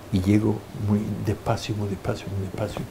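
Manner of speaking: softly, sinking almost to nothing; tiptoeing in the dark, barely voiced; hushed telling. Creeping toward the dark confessional, hushed and fading.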